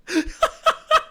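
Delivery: high squealing laughter; a loud high cackle of delight; squealing giggle fit; shrieking laugh. Real, not acted. Laughing at own joke.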